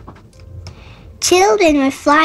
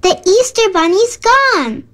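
higher-pitched storybook outcry; mild alarmed shout; 5 semitones higher than usual. A narrator's reading. Woman tells everyone the bunny's gone, mild alarmed shout. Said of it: "shouted a woman".

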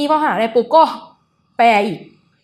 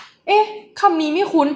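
high, slow, puzzled musing; slow puzzled wonder; 7 semitones higher, slower than usual. Retold or imitated. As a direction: linger on the strange word, puzzled. Retells own puzzled reaction. (สงสัยช้าๆ เสียงสูง)

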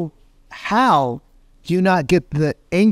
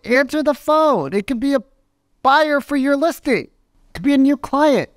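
high, pushy nagging voice; urgent pleading; 12 semitones higher than usual. Retold or imitated. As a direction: push him to grab that call. Urging friend to answer phone.

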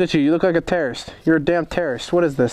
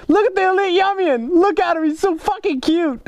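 much higher, squealing cooing voice; cutesy coo; 10 semitones higher than usual. Acted. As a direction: gush over the photo like over a baby. Cooing over a fan's photo.

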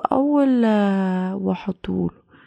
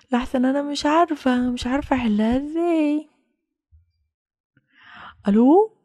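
normal talking voice

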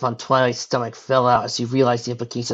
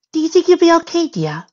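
high-pitched anxious question; fretful character voice; worried; 7 dB louder than usual. A narrator's reading. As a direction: ask with concern, pitched high at first. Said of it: "Rarity asked Fluttershy worriedly".

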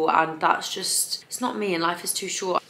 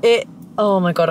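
louder, slow and thrilled; excited; 3 semitones higher, 7 dB louder, slower than usual. Real, not acted. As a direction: gush about it, delighted. Delighted with new hair.

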